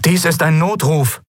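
high, urgent radio distress call; high-pitched emergency call over a bad link; distress caller. A narrator's reading. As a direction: voice a stranger calling for help, pitched high. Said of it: "rauschte unerwartet eine fremde Stimme".

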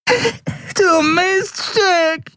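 very high, long-drawn wail of pain; yowling. A narrator's reading. Dealer clutches his broken nose, yowling. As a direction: howl it slowly through a hurt nose. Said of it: "jaulte er".

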